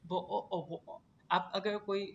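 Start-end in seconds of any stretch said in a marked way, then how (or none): 0.1-1.0 "वो ओ ओ वो ओ": bottle of water with glottal stops for Ts; British accent, drop each T into a glottal catch. Shown as a demonstration. Teacher showing class British bottle of water, T's silent. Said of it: "टी होता है उसमें हम उसको बिल्कुल ही silent कर देते".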